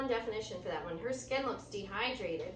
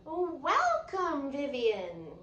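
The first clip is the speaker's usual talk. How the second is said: high, slow, bright call of welcome; cheerful teacher welcome; 4 semitones higher, 5 dB louder, slower than usual. Acted. Teacher greeting a student, called out high and slow. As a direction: sing out a hello to the late student. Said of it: "called Mister J".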